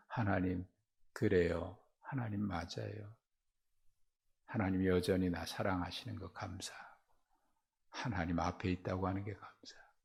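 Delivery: soft, subdued prayer of thanks; quietly retold surrender to God; accepting prayer.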